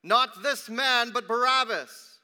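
high, very loud, drawn-out cry; crowd crying out. A narrator's reading. Crowd demanding Barabbas from Pilate, loud outcry. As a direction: shout it like a demanding mob. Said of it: "they cried out again".

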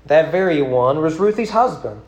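rhythmic, lilting poem read aloud. Acted. Preacher reciting a rhyming poem to his congregation, lilting. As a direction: recite the rhyme with a storyteller's swing.

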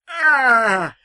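loud, high mock agonised shout; anguished Heston cry. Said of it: "It goes an".

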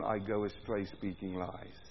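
low quiet quote. Acted. Quoting David, dropping low.

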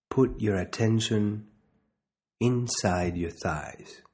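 slow, measured meditation guide with long pauses; unhurried body-scan instruction, leaving space to breathe; guided meditation. Real, not acted.

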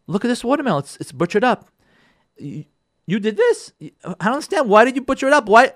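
high-pitched, incredulous acted complaint; upset wife. Wife questioning husband about watermelon, upset. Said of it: "she's getting so upset".

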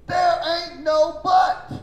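slow, loud, high preaching shout; emphatic rebuttal. Real, not acted. Preacher driving the point home to congregation, emphatic shout. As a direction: hammer each word out slowly and loudly.

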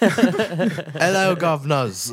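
high, hammed-up British greeting; mock British accent. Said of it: "Is that British?".

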